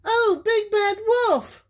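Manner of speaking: high mother duck exclamation; high-pitched duck cry. A narrator's reading. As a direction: cry out to the wolf, high and emotional. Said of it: "cried Missus Duck".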